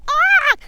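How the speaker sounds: loud, very high turkey scream; startled squawk. Acted. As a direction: shriek in fright as you bolt.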